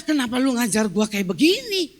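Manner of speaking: indignant retort, voice jumping up at the end; retold indignation. Wife challenging her husband's coaching, acted indignation. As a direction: re-enact an offended protest. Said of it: "Saya mulai marah".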